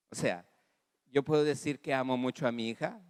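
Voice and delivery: normal talking voice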